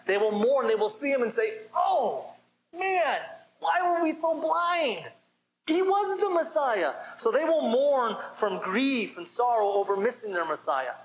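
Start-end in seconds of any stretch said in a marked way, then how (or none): 1.7-6.9 high, slow, stricken cry of regret; anguished lament. Pretend. Mourners crying out to the Messiah they missed, in high anguished regret. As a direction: wail it like someone who just realized too late. Voicing mourners realizing their blindness.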